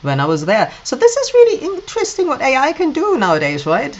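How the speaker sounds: usual speaking voice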